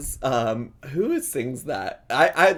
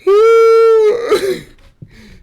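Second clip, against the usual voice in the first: loud, high "hoo" after laughing; laughing sigh; 14 dB louder than usual. Real, not acted. Recovering from laughing fit.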